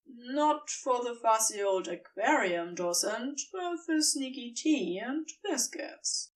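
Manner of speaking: plain reading voice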